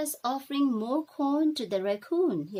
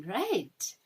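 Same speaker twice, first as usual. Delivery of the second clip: usual speaking voice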